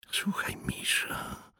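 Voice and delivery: urgent slow whisper. A narrator's reading. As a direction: pull him aside and whisper. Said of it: "powiedział szeptem poeta".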